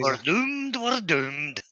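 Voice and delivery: lightly voiced gloomy Scot; a hint of the doomsayer; Fraser from Dad's Army.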